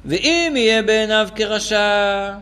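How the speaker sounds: slow, chanted reading of the source; solemn text reading. Shown as a demonstration. The teacher reading the Tanya quote aloud, slow and solemn. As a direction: intone the Tanya line slowly. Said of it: "נקרא את זה בפנים".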